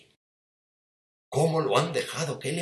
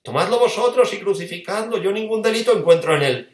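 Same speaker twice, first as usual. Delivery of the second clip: high, loud, fed-up outburst; exasperated shout; 8 semitones higher, 6 dB louder than usual. Acted. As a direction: throw it back at the crowd. Pilato shouts at the crowd.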